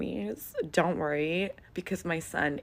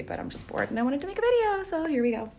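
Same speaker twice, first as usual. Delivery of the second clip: much higher, fast, bubbly; playful; 11 semitones higher, 4 dB louder, faster than usual. Real, not acted. Telling viewers she's bored, in a high quick chirp. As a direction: chirp it carelessly.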